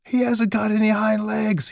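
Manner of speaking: high-pitched jeering call; rabbit calling out. A narrator's reading. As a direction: announce it high, as a mocking storybook rabbit. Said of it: "he called out".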